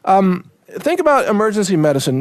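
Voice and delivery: ordinary delivery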